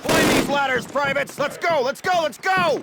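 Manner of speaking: high, loud barked orders; drill sergeant shouting. Acted. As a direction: yell over the gunfire. Drill instructor yelling under fire.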